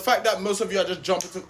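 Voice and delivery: ordinary talk, nothing marked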